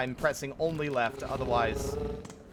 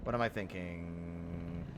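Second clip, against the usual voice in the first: quiet muttering; 8 semitones lower, 8 dB quieter, slower than usual. Real, not acted. Muttering to self.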